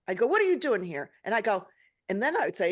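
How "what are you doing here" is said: raised, indignant question at a dead father; retold angry demand. Daughter challenging her dead father in a dream, indignant.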